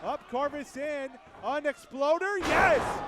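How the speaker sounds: rising loud exclamation; excited shout. Real, not acted. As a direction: build to a triumphant yell. Sudden save, excited call.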